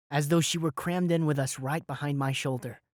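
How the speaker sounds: ordinary delivery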